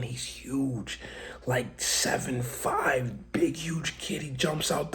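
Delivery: unvoiced whisper in awe; awed whisper. Real, not acted. Describing the giant kid from the van, awed whisper. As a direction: hush it like telling a secret.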